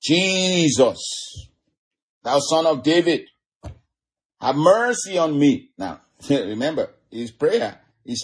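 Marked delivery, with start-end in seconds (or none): 0.0-5.7 high, pleading call for mercy; crying out. Acted. Blind beggar calls to Jesus passing by, crying out. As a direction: call out long and high to be heard. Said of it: "he began to cry out".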